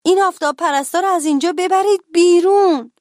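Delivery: high, loud acted yell of outrage; angry shopkeeper yelling them out; cashier's shout. A narrator's reading. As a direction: shout the order, building to the last word. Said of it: "صندوقدار فریاد زد".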